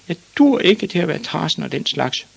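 put-on high-pitched whiny boy voice; complaining boy. A narrator's reading. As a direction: protest as the small, defeated son. Boy reproaches father.

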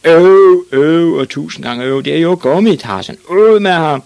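loud, high jeering boo; crowd shouting. Acted. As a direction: boo him off the pitch. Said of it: "begyndte de at råbe".

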